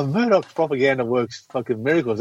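ordinary delivery

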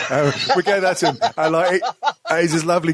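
giggling while telling the story; laughing. Real, not acted. Telling mates about the sawn-off toy bus, laughing.

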